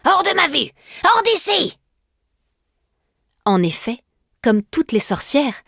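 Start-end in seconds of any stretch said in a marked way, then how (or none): 0.1-1.8 furious shriek. Acted. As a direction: drive her out, screeching high. Witch chases girl away.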